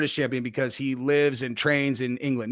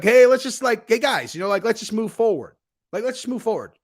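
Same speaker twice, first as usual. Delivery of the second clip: acted upbeat plea; 7 semitones higher, 3 dB louder than usual. Voices people pleading to move on.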